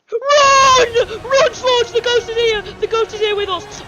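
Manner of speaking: loud, high scream of warning; panicked shouting. Pretend. Warning Slush the ghost is here, panicked shouting. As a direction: yell for them to flee, frantic.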